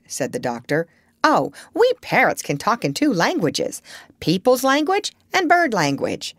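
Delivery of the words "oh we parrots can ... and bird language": high, boastful parrot voice; pitched up and pleased with herself; proud parrot. A narrator's reading. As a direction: show off as a proud bird. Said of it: "said Polynesia proudly".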